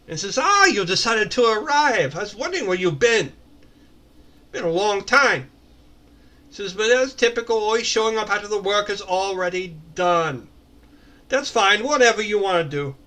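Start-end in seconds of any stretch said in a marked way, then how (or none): 0.4-5.5 put-on high, sarcastic, over-the-top accented voice; David Lopan. Tanema greets the latecomer in a sarcastic, hammed-up accent. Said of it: "sarcastic, over-the-top accent of David Lopan". 6.7-12.9 put-on raised, loud, sarcastic over-the-top accent; David Lopan. Tanema chides the latecomer, sarcastic in a hammed-up accent. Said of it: "sarcastic, over-the-top accent of David Lopan".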